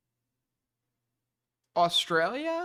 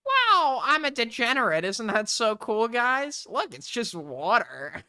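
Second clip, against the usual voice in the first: high, over-excited mock boasting; mocking drunk show-off; 5 semitones higher than usual. Mocking the drunk driver's showing off.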